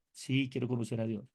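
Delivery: soft, meek mock seminarian; a softly mocked eager first-year; humble seminarian. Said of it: "como humildes".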